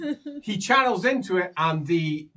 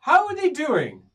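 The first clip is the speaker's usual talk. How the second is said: high elf question; 12 semitones higher, 7 dB louder than usual. Acted. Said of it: "elf says".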